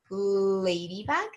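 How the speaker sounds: "ladybug" slowly, with a long L and rising end; stretched first sound. Shown as a demonstration. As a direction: draw out the L of "ladybug". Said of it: "have the same first sound".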